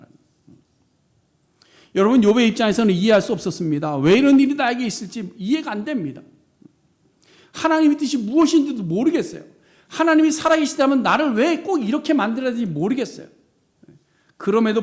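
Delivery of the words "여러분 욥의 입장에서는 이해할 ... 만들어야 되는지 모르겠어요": loud, high, anguished sermon plea; impassioned preaching. Acted. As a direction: voice Job's bewilderment forcefully. Preaches Job's bewilderment at full force.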